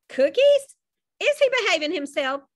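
high surprised mother's voice; mother checking on her son, surprised; Mrs Hatford. A narrator's reading.

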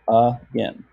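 AGAIN split as uh, then gain; AGAIN in two beats, uh... gain; syllable by syllable. Shown as a demonstration. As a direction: separate the first A of AGAIN as its own beat. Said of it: "just a one-letter syllable".

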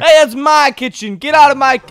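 loud territorial order; angry shout. Pretend. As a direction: yell at someone trespassing in your kitchen. Yelling at intruder in kitchen.

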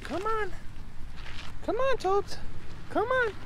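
high sing-song call to the dog; coaxing dog voice. Real, not acted. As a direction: sweet-talk the dog into walking.

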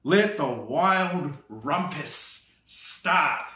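slow, drawn-out royal announcement; slow grand proclamation. Acted. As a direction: proclaim it like a boy king. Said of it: "cried Max".

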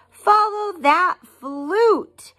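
high, slow chasing call; group yell. Acted. Fifi and Frog call after the flute, high and slow. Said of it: "yelled Fifi and Frog".

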